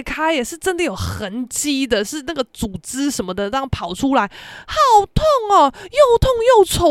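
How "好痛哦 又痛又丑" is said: whiny complaint. Retold or imitated. Whining about the pain.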